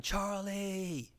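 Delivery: high, carrying call across a crowded room; yelling a name. Retold or imitated. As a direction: shout his name to get his attention. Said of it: "And I start yelling".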